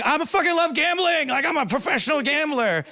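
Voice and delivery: hammed-up, high-pitched giddy boast; mock excitement. First-timer at the casino boasting, giddily overexcited. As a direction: crow like a thrilled beginner, exaggerated.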